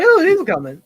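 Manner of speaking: high, loud knowing promise; teasing dad. Retold or imitated. Dad teasing his son, high and loud. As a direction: tease it like a dad in on it. Dad's reply voiced.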